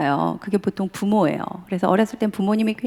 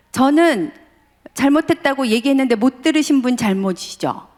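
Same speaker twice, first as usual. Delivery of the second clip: higher, louder, needling retort; provoking son; 5 semitones higher, 4 dB louder than usual. Retold or imitated. Son provoking his angry father, needling retort. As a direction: rub it in to get under his skin. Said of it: "부아를 긁었어".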